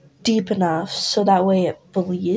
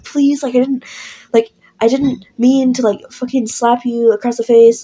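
higher, louder desperate plea; pleading scream; 4 semitones higher, 7 dB louder than usual. Retold or imitated. Listener begs Vance after slapping him, loud and pleading. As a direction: beg him, half-screaming, to forgive you. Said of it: "screaming at him".

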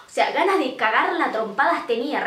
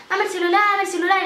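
put-on tough-girl demand; 6 semitones higher, faster than usual. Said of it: "acompañando al movimiento turresco".

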